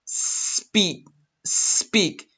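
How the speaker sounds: "speak" with a long hissed S first; "speak" with no vowel before the S; long S sound. Shown as a demonstration. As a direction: draw out the S, "sss-peak". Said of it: "like the sound of the S".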